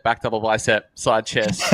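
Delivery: ordinary delivery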